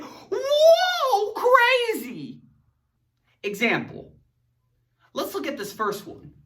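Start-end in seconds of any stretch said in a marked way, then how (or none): 0.0-2.4 high loud whoa with a laugh; mock wild excitement. Shown as a demonstration. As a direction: burst out like it's crazy. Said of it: "an exclamation point makes it".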